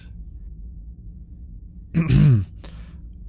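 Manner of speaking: flustered ahem before explaining; self-conscious clearing of the throat; awkward throat-clear. Acted. Embarrassed by own suggestion.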